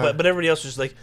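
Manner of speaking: ordinary delivery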